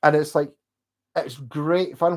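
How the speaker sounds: ordinary talk, nothing marked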